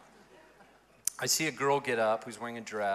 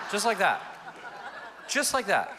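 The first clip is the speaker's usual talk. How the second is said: louder and higher, laughing through it; 9 semitones higher, 7 dB louder than usual. Real, not acted. Pastor marvelling at the quick conversion, laughing loudly.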